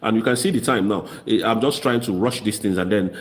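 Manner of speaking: ordinary talk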